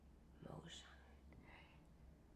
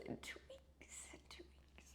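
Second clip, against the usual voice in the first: whispering; 21 dB quieter than usual. Real, not acted. Whispered emotion.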